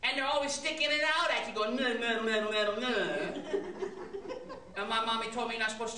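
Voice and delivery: put-on fast, babbling kid voice; rambling child. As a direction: chatter like a little kid. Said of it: "saying things like".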